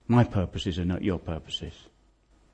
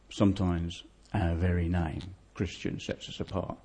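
hushed and earnest; 5 semitones lower, 7 dB quieter than usual. Real, not acted. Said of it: "quiet earnest application".

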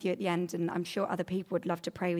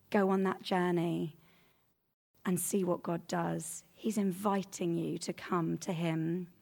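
normal talking voice

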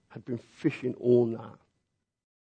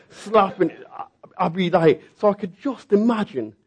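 laughing; 7 semitones higher, 5 dB louder than usual. Real, not acted. Laughing at his own grumbling.

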